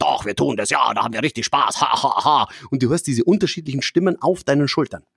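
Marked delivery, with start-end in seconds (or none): 0.0-2.5 a hint of a lower, gleeful little devil; lightly voiced devil, mocking laugh at the end. Devil on the shoulder egging on, lightly voiced. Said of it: "der Teufelchen auf der rechten Schulter sagt".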